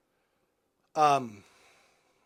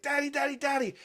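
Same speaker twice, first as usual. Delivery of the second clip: put-on higher-pitched child calling Dad; imitating eager daughter; 10 semitones higher than usual. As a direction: mimic a little girl running up to her father. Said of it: "my daughter just runs up to me".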